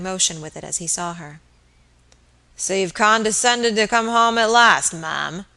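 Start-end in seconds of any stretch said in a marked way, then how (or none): 2.6-5.5 put-on slurred, sneering drunk; loud, slow drunken sneer; drunken brute. Drunken landlord sneering at wife.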